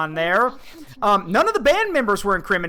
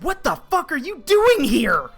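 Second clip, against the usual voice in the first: retold angry shout; 7 semitones higher than usual. Bandleader confronting the dad at the seedy venue, startled shout. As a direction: shout it like a friend seeing you somewhere you shouldn't be. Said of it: "He said".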